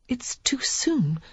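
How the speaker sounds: slow frightened whisper; fearful whisper. A narrator's reading. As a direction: whisper it, dazed. Lily whispering in fear.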